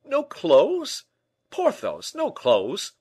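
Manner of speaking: loud, high, incredulous questions; exclaim, flabbergasted; astonished cry. Acted. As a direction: echo it back in utter disbelief. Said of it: "he cried".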